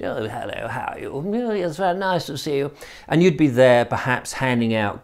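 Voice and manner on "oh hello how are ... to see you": mock posh party chatter, softer; airy upper-class small talk, kept quiet; a light send-up of posh guests greeting each other. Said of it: "the posh people would come in".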